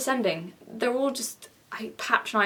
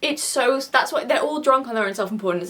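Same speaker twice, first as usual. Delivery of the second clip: ordinary talk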